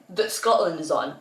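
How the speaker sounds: usual speaking voice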